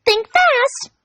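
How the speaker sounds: spring it on her gleefully; gleeful warning. Acted. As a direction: Pinkie warning before yanking the wing, gleeful. Pinkie's playful cruel warning.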